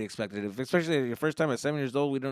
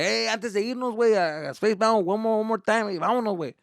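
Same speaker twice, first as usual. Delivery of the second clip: higher, lively imitated uncle; eager uncle; 8 semitones higher, 4 dB louder than usual. Uncle before leaving, mildly eager urging. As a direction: lightly urge the family on. Uncle urges one more ride.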